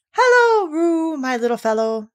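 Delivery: high, loud hello easing down; big sing-out greeting to a small child; hearty greeting. A narrator's reading. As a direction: hail a little one with gusto. Greeting little Roo heartily.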